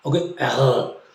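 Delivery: ordinary delivery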